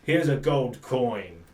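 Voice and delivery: grudgingly reward the try; loud grudging guard voice; gruff guard. Acted. Guard praises a player.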